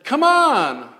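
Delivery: loud, high, drawn-out challenge; yelling across a valley. Retold or imitated. Goliath calling out the Israelites, taunting yell. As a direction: holler a taunt across a great distance. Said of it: "he's yelling across the valley".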